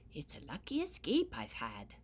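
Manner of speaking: usual speaking voice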